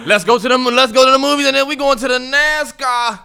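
high, loud mock announcement; loud acted quote.

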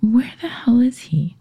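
hushed, worried whisper to herself; whispered worry. Retold or imitated. Wife alone at night wondering where husband is, whispering. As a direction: mutter it under your breath, anxious. Retelling her worried self-talk.

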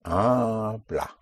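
Habla with a long stressed first "ah"; drawn-out "HAAH-bla"; push down, surface on A. Shown as a demonstration. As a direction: lean on the first syllable of Habla, end on clear "ah". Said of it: "you push down and you surface on A".

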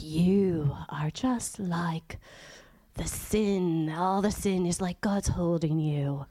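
slow, low monotone sermon; hushed, drawn-out monotone warning; monotone preacher. Retold or imitated. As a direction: imitate a droning, intense preacher reading slowly. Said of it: "he says it all monotone".

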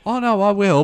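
high, loud, sheepishly pleading voice; sheepish high reply. Retold or imitated. To his wife about the wedding, high sheepish protest. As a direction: squeak it out like a guilty husband. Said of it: "And I'm like".

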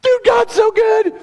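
high, loud and slow praise; an overjoyed preacher sharing his thrill; joyful exclamation. Real, not acted. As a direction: savour each word with delight.

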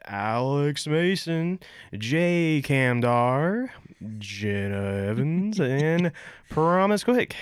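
names stretched out slowly like a mock announcer; hammed-up roll-call announcer, very slow; half-committed announcer voice. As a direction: draw out each name, playing announcer without fully committing. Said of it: "you wanted to do the announcer voice".

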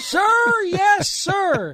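high loud shouted sir yes sir; recruit shouting back. Retold or imitated. Recruit answering first sergeant.

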